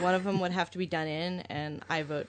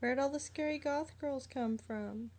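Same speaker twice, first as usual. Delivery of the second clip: high, softer voice of a lost concertgoer; mock bewildered preppy girl; 8 semitones higher, 4 dB quieter than usual. Preppy girl lost at a goth concert, mock bewildered. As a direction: put on a small, high, puzzled voice.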